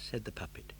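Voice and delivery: ordinary delivery, nothing marked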